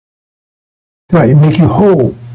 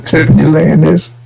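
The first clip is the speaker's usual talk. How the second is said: usual speaking voice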